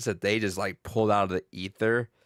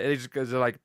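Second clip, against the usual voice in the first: usual speaking voice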